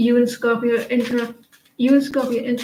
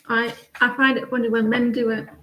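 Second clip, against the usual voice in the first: ordinary talk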